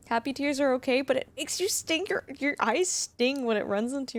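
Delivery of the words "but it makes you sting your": ordinary talk, nothing marked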